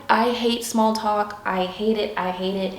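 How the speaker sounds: ordinary talk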